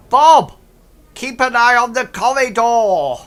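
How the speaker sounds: very loud, high-pitched yell; goblin shout. Acted. Goblin inside ordering Bob to guard the corridor, full shout. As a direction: bellow an order out from inside the room.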